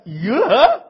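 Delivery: sudden high, loud startled yelp; surprised cry. Acted. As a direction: jump at the sight of her. 老太太一看认出儿媳. (惊讶地高声叫)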